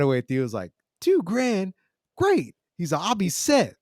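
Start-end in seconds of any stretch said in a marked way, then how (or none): 1.0-2.5 high-pitched, mock delighted yelp; excited teenager. Narrator imitating Theo finding two grand, excited. 3.0-3.8 high-pitched mock delight; Theo, excited. Narrator imitating Theo sure he is set, excited.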